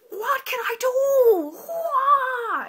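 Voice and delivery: high, loud, drawn-out mock bawl; theatrical crying shout; hammed-up wailing. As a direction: bawl it slowly in utter despair. Acted despair at the grade.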